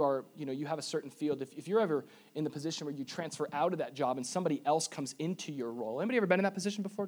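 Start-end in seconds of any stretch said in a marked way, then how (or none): 6.0-7.1 quick aside. Real, not acted.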